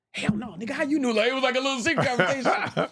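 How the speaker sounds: high-pitched comic astonishment; retold disbelief. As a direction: protest as if caught off guard. Acting out his startled reply.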